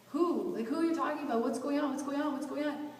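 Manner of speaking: high, urgent re-enacted questioning; retold alarm. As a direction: act out panicked confusion, pitch up. Acting out her alarmed questions.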